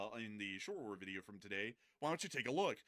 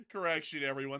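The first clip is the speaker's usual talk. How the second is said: laughing; 4 semitones higher, 9 dB louder than usual. Real, not acted. Laughing at own misattribution.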